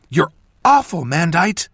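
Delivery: put-on high, loud young woman's rebuke; indignant accusation. A narrator's reading. Apprentice accosting mentor outside the farmhouse, indignant.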